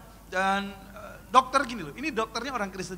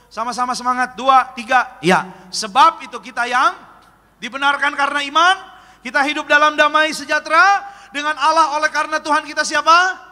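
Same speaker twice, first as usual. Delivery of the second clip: loud, raised scripture proclamation; rousing group reading; 5 semitones higher, 11 dB louder than usual. Acted. Preacher leading congregation in Romans verse, loud raised proclamation. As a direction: lead the congregation through the verse, projecting hard.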